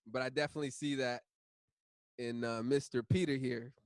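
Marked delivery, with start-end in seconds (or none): none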